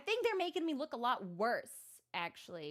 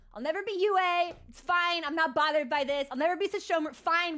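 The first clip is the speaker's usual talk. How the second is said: higher, louder mock tantrum; half-joking bitter whine, raised and loud; mock whiny outburst; 5 semitones higher, 6 dB louder than usual. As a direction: throw a pouty fit about never being them.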